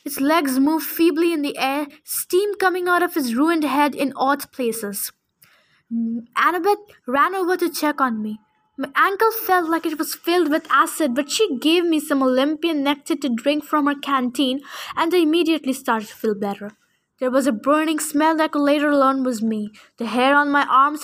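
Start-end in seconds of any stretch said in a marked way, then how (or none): none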